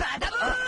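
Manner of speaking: high, loud shout breaking into laughter; shouted cackle of glee; gleeful shout and laugh. Acted. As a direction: crow with delight before striking. Gleeful villain before the kick.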